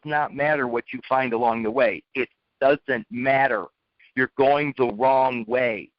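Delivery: measured, weighty delivery; slow emphasis. Real, not acted. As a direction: spell the moral out slowly. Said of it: "slowing down to drive point".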